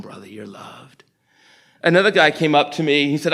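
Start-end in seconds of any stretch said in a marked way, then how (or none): 0.0-1.0 tender reassurance. Retold or imitated. Imagined words to a troubled man.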